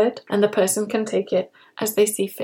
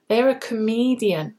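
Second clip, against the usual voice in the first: usual speaking voice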